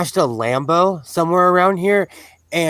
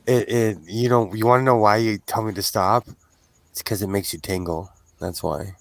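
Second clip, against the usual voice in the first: low, soft seductive radio purr; sexy radio voice; 8 semitones lower, 3 dB quieter than usual. Acted. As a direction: drop low like a late-night DJ. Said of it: "your sexy radio voice".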